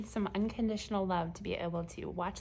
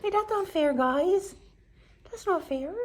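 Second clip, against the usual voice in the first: mock protest; 8 semitones higher, 7 dB louder than usual.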